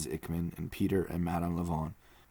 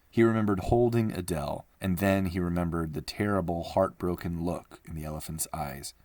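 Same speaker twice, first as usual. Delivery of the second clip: ordinary delivery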